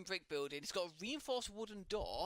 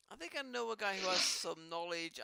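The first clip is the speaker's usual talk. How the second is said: ordinary talk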